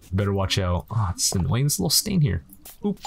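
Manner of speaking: normal talking voice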